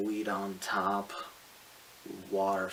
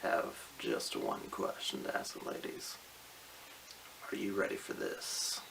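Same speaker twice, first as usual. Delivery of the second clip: whispering; 2 semitones lower, 10 dB quieter than usual. Acted. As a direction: breathe it like a secret. Whispered come-on.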